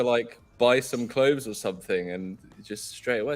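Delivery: usual speaking voice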